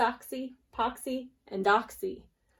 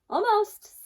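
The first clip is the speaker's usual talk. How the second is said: sly fox; 11 semitones higher, 7 dB louder than usual. Acted. Fox answers sweetly.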